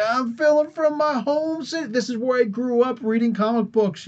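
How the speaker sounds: high-pitched, playful pretend vlog intro; mock proud announcement. Imagining filming at his hometown comic store, mock proud.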